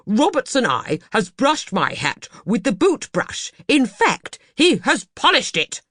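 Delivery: angry complaint. Acted. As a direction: fume over the ruined hat. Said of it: "retorted Mister Banks".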